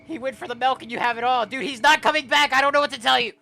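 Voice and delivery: high, very loud mock rant; exasperated shouting. Yelling mock rant at character.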